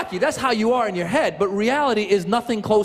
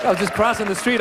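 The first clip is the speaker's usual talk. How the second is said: usual speaking voice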